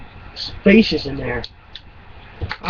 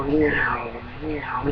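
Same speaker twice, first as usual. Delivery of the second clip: low, soft engine "meow"; imitated engine whine; 4 semitones lower, 11 dB quieter than usual. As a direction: imitate a feeble little car engine. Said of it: "and I go".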